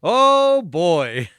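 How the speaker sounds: high, loud, drawn-out dismay; mock alarm. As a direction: wail it in exaggerated dread. Mock alarm at huge dose.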